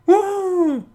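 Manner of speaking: very high Mario-style woo. Acted. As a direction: whoop it like Mario. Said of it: "Maybe I should have gone for a Mario voice".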